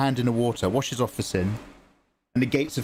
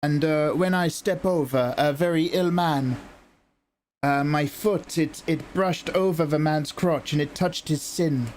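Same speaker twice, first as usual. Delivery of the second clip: higher, hesitant Italian nun; lightly voiced Italian nun, higher and faltering; Italian nun confessing; 4 semitones higher than usual. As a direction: stumble through the confession with a light Italian lilt. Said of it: "And she goes".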